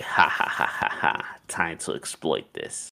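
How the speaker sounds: whispered evil scheming cackle; hushed cartoon-villain gloat; mock villain laugh. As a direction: play the sneaky villain rubbing his hands.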